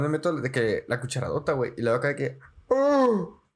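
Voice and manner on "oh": high, loud cross between a moan and a bark; half moan, half bark from scalding food. Retold or imitated. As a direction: yelp from burning your mouth. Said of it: "entre gemido y ladrido".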